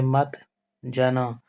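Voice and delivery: मत, a short pause, then जन; pause between words. Shown as a demonstration. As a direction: split मत and जन with a brief silent gap. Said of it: "मत जन के बीच थोड़ा विराम है".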